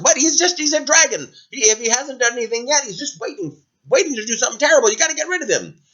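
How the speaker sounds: high, urgent villagers pleading; worried townspeople, high and pressing; fearful townsfolk. Acted. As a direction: rush it, insisting the dragon must go. Townsfolk insisting on danger.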